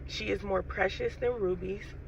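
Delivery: ordinary delivery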